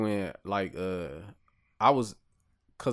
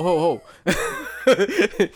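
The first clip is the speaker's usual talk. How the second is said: long drawn-out ho ho of disbelief; slow stunned laugh; 8 semitones higher, 8 dB louder, much slower than usual. Real, not acted. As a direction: let a slow, loud ho ho roll out in shock. Shocked at eating pork sausage.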